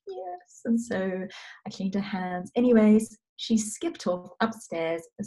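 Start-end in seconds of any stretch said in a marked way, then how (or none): 0.1-0.6 tiny, very high, soft voice; imitated meek child. Mother voicing daughter finally accepting help, small and meek. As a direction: squeak it out like a small child giving in.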